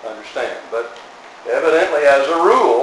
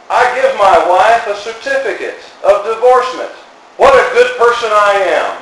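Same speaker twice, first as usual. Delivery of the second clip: mock self-praise; 6 semitones higher, 5 dB louder than usual. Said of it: "They would say".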